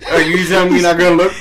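ordinary delivery

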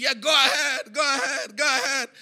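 mock yell. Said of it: "the guy started screaming".

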